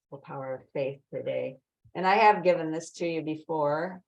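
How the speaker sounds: ordinary talk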